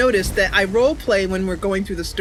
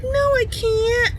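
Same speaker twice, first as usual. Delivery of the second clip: whiny refusal; slower than usual. Retold or imitated. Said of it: "I was like".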